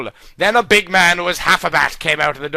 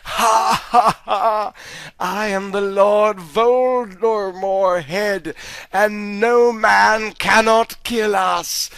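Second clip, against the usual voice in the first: slow, strange villain voice; slow sinister Voldemort head; second villain head; 2 semitones higher, slower than usual. Acted. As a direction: speak slowly as the evil second head. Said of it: "his other head on the back of his head said".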